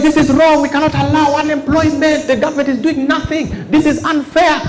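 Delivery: high, ranting protest voice; mock protester. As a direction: rant like an outraged activist. Acted protest quote, high pitch.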